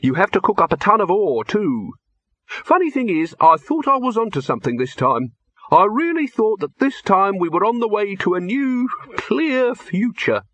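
high-pitched, uncertain inventor; disappointed experimenter in a high voice; doubtful alchemist. A narrator's reading. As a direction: pitch it high, slowing right down at the end. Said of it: "said Silverfish doubtfully".

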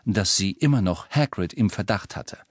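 plain reading voice

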